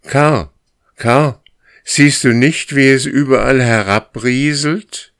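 loud, high calling question; urgent loud call through the trees. Acted. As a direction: call out to make him notice. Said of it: "fragte Graufell".

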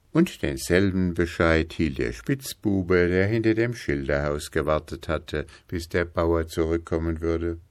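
usual speaking voice

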